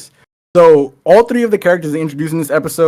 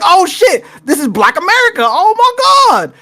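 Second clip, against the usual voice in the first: thrilled discovery; high-pitched gleeful surprise; excited realization; 13 semitones higher, 3 dB louder than usual. Retold or imitated. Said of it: "I was like".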